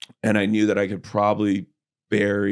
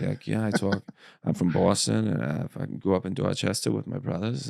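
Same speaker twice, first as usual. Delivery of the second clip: Mark Wahlberg Boston impression; 5 dB quieter than usual. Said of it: "my Mark Wahlberg".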